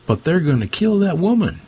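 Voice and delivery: high-pitched, urgent objection; alarmed protest. A narrator's reading. Cameraman objects to filming a killing, alarmed protest.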